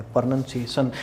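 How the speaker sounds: no "ow" in "प्रोनंसिएशन"; correct short vowel. Shown as a demonstration. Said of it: "ये प्रोनाउंसिएशन नहीं".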